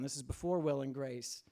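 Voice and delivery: ordinary delivery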